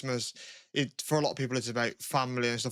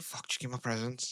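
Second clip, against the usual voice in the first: impatient inner thought; 8 dB quieter than usual. Retold or imitated. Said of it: "I used to just think".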